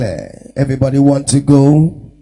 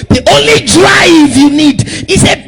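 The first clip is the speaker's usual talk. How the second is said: loud, high, sing-song sermon cadence; full-force preaching; fiery preaching; 9 semitones higher, 4 dB louder than usual. Real, not acted. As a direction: rouse the congregation to action.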